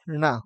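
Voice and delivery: "ण" with tongue curled back; curled-tongue n opening to a in "ण"; retroflex nasal. Shown as a demonstration. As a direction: say "ण" alone as a retroflex na. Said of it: "इनका सही उच्चारण".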